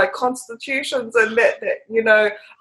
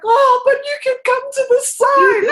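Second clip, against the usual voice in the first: retold plea; 12 semitones higher than usual. Viewer begging a film character to switch sides, high retold plea. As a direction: plead in a much higher voice.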